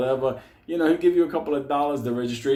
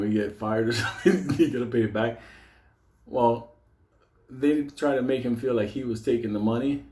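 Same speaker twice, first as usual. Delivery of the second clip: ordinary talk, nothing marked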